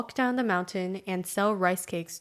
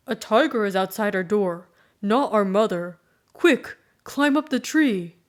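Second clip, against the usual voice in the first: urgent warning; 5 dB louder than usual. Acted. Boy warns sister urgently.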